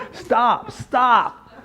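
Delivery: high mock yell of stop; imitating the panicked psychologist yelling stop; retold scream. Psychologist yells at Mary running to pool, mock scream. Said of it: "He starts screaming".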